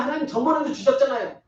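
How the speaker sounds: mock whining believer; whiny demand to God. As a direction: nag God like a spoiled child. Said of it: "맨날".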